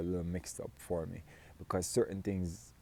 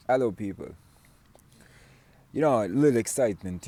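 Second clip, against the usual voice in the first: mildly excited, louder greeting to viewers; a little excitement; 3 semitones higher, 6 dB louder, faster than usual. Real, not acted. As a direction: open the video with a touch of excitement. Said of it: "have a little excitement for the video".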